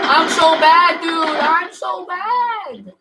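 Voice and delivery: high, loud, slow drawn-out groan; self-mocking wail. Real, not acted. Streamer moaning about his own bad play, high drawn wail.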